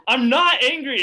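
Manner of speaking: high, loud angry denial; retold yell. To friends in the hallway, yelling he's not angry. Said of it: "I'm, like, yelling at them".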